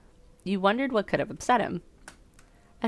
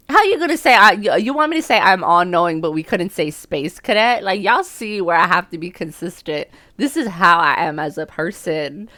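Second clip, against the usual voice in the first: indignant rant; 8 dB louder than usual. Real, not acted. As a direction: argue your case loudly.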